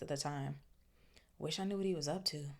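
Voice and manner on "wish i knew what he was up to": softer, quicker, puzzled; understated wondering; quiet frowning. Acted. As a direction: mutter it suspiciously.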